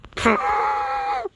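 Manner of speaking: very high-pitched mock scream; hammed-up squeal of rage; angry screech. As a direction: let out a long, high cartoon screech. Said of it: "he's really angry".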